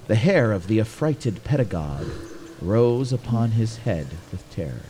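very low, soft storyteller voice; hushed, ominous narration. A narrator's reading. Narrator describing the figure in the gloom, hushed suspense. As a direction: bring it right down for suspense.

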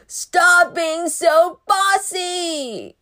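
high, slow, whining complaint; whiny children. A narrator's reading. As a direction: drag it out like annoyed kids. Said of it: "they said together".

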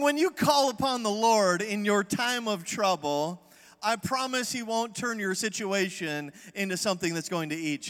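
loud, high, driving sermon voice; fiery preaching. Acted. Pastor exhorting the congregation after the joke, preaching loudly. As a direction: preach it to the back row, loud.

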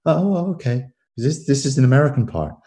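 high, quick imitation of a director; Catherine Bigelow. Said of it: "and she's like".

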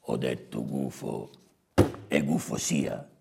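very low, soft creaky growl; rough, hushed boss pronouncement; hornet boss. Acted. Voices Calabrone insisting.

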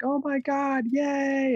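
very high, gleeful cheer in imitation; hammed-up high-pitched jubilation; mock celebrating. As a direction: squeal it like an overjoyed crowd. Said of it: "all celebrating".

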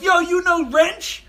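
very high, loud, drawn-out question; mock over-eager bro; excited bro. Office bro quizzing newcomer about locals, high and eager. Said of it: "He's like".

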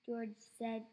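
ordinary delivery

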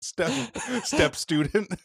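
loud, high hammed-up whine; mock step-bro line. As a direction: ham up the step-bro cliché.

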